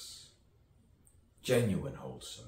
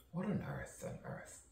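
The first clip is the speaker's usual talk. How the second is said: whisper; 12 semitones higher, 8 dB quieter than usual. Acted. Whispered musing to self.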